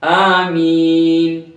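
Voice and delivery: slow melodic tajweed chant; slow sung Quran recitation. Shown as a demonstration. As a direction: draw each word out, recite.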